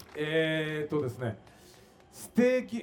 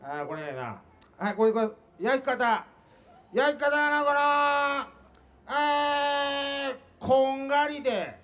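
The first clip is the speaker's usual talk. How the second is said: 篠原慎 impression; 6 semitones higher, slower than usual. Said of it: "焼き方を聞かれた時の柔道家、篠原慎さん".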